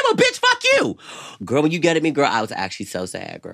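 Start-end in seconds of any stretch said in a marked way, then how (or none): 0.0-1.0 high, squeaky mock comeback; a hammed-up high snap back; mock cussing out. Imitating her friend snapping back at her. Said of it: "You said".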